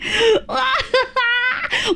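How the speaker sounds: high bright burst of giggling; giggle. Real, not acted. Streamer giggling at chat's teasing, a high burst of laughter. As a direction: giggle at your own joke.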